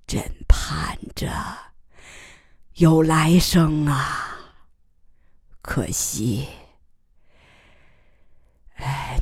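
slow, faint whisper; dying words. Acted. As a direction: let the breath carry the words. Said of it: "他声音细弱，断断续续地说".

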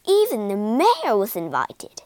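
usual speaking voice

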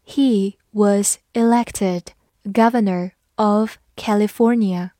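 "He was elected governor of California" read slowly. Shown as a demonstration. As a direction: take each word slowly. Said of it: "慢慢来读".

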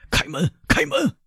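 high, anxious demand at a door; strained, high-pitched order; calling out. A narrator's reading. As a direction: call urgently to be let in. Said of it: "威勒夫叫道".

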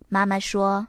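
plain reading voice

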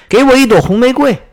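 calling out loud. A narrator's reading. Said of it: "大声对那棵玫瑰树说".

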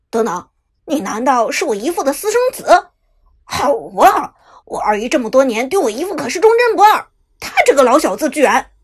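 loud, fast indignant rant; outraged on behalf of his aunt; frowning indignation. A narrator's reading. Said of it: "忽然皱眉道". (皱眉愤慨地说)

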